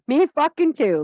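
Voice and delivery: ordinary talk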